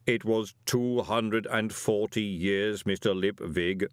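slow, measured golem voice; placid golem. Acted. Golem correcting Moist, placid and slow. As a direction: answer ponderously, evenly weighted.

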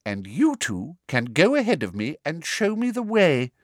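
cool official orders; teacher giving orders to pupils; Umbridge voice. A narrator's reading.

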